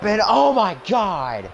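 excited. Acted. Shocking move in match.

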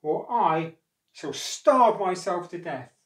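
loud, slow dramatic ultimatum; snappish threat. A narrator's reading. Wife threatening crocodile to get her way, snappish. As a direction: threaten with full, drawn-out force. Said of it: "snapped his wife".

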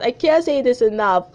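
ordinary delivery

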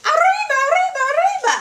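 high, excited whoop; playful high-pitched cheer; festive cry. Acted. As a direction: call it out like a fiesta cheer.

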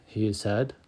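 ordinary delivery, nothing marked

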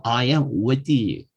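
very slow, word by word; drawn-out model sentence; slow demo. Shown as a demonstration. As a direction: spell it out slowly for learners. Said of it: "해봐요".

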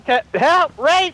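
mock cry for help. Player pretending to be attacked, mock cry for help. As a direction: call out in playful alarm.

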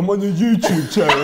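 laughing mock echo. Teasing friend about new YouTube channel, laughing echo. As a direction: echo it back, cracking up.

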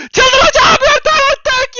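about to break down from stress; near tears. Real, not acted. Streamer overwhelmed by trolls. (ağlamaklı, çaresiz öfke)

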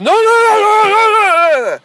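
very high, loud string of alarmed cries; imitated panicked yelling. Family yelling at girl with finger on the trigger, imitated yelling. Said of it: "you guys were yelling at her".

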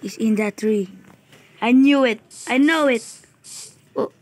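ordinary talk

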